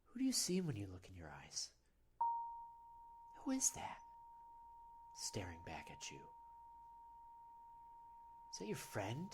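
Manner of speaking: soft, half-whispered probing question; hushed meditation. Pretend. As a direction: let the question sink into a hush.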